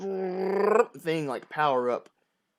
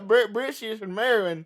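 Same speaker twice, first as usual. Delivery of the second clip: put-on southern accent; 6 semitones higher, 6 dB louder than usual. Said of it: "calling him British in a southern accent".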